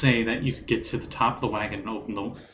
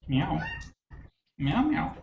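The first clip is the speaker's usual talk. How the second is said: cat meow; 6 semitones higher, 5 dB quieter, slower than usual. Acted. GM voices the cat Garfield.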